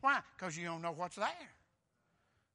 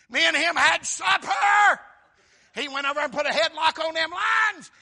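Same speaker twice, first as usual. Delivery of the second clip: triumphant shout; 8 semitones higher, 17 dB louder than usual. Acted. Preacher acting out the man saved from lions, triumphant shout. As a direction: shout it out as a boast. Preacher loudly acts out Daniel's boast.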